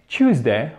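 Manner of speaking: British Tuesday, said as CHOOZ-day; British English pronunciation. Shown as a demonstration. Teacher contrasting accents, British English Tuesday as CHOOZ-day. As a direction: start Tuesday with a British CH sound. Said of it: "en British English".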